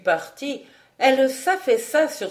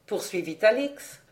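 ordinary delivery

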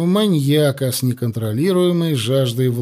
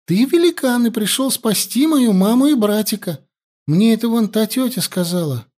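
put-on much higher, chirping child voice; little girl; 8 semitones higher than usual. A narrator's reading. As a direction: chatter like an eager child. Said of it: "прощебетала девочка".

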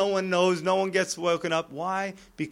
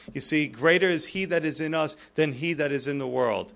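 usual speaking voice

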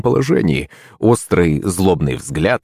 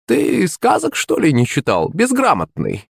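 high mocking crone voice; sneering; 9 semitones higher, slower than usual. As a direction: sneer at his ignorance. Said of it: "Старуха прищурилась".

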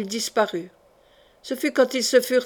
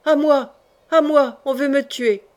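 higher, urgent call for rescue; cry for help; 3 semitones higher, 3 dB louder than usual. A narrator's reading. The mad king calls for help against his killers, alarmed cry. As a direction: call out in alarm, easing slightly at the end.

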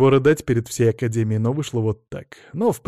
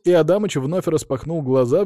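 ordinary delivery, nothing marked

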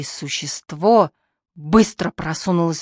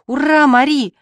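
put-on loud high cheer from a little boy; boy shouting hooray, loud and high; 6 semitones higher, 5 dB louder than usual. A narrator's reading. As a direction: cheer her on like a thrilled child. Boy cheering over victory.

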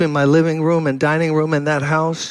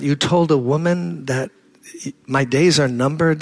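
usual speaking voice

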